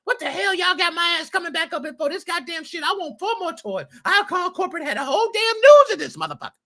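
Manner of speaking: high, furious mock mother, speeding up at the end; angry mama. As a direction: play an outraged mom yelling at the counter. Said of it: "Mama Moses been had every".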